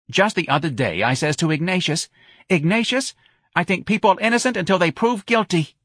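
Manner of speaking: high-pitched New Orleans mother; earnest older local woman. Acted. Mother repeating what she told her son, earnest.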